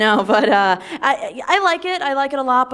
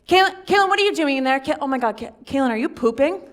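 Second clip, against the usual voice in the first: put-on high, louder kid voice pestering; nagging kid; 7 semitones higher, 7 dB louder than usual. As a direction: tease her like a bratty child through the door. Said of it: "they're like".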